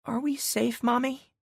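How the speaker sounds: put-on high, tiny child's squeak; a small scared kid asking; squeaky little girl. A narrator's reading. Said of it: "Daisy squeaked".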